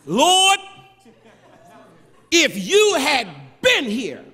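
accusing. Acted. Martha confronts Jesus angrily.

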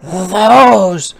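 choosing spider. A narrator's reading. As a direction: point and pick eagerly. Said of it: "chose the spider".

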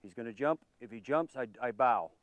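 ordinary delivery

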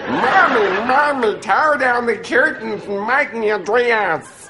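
high, slow southern belle drawl; southern American accent. Shown as a demonstration. Proving he can do it, high southern drawl. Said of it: "Can you do a southern American accent?".

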